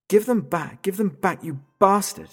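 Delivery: high, loud furious demand; angry shout. Acted. Father screams at the kidnapper to return his children. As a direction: roar it down the phone.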